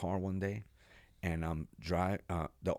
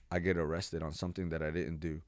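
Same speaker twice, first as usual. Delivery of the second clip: ordinary delivery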